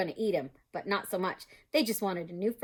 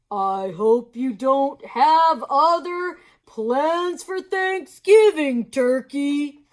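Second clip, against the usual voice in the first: slow, louder smiling moose voice; toothy grin; 7 semitones higher, 12 dB louder, much slower than usual. Acted. Mister Moose grins down at the fallen Turkey. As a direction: grin through every word. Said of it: "smiled his great toothy smile".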